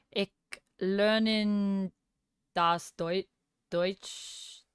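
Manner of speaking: slow, unsure German with gaps between words; halting beginner German. Real, not acted. Streamer trying out the German they are learning, halting beginner German. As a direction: feel your way through German, ending in a questioning rise. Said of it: "my bad German".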